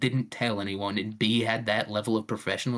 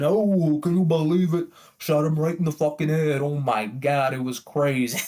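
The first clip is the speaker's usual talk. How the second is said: starts high and loud, easing toward the end; hammed-up Italian bragging to his buddies; blabbing Italian; 4 semitones higher, 3 dB louder than usual. Italian in the deli telling the others, mock-amazed boasting. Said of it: "They're always telling the other wops".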